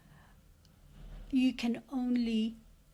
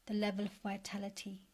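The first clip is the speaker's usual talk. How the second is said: guided meditation. Acted. Coach leading listeners to picture their best life, guided meditation. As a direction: guide them gently, leaving space after each phrase.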